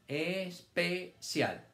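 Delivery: very slow, es-pe-cial split into syllables; sounded out syllable by syllable. Shown as a demonstration. Teacher reading aloud to young pupils, syllable by syllable. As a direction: stretch each syllable apart for the children. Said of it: "Leer es hacer los sonidos todos pegaditos".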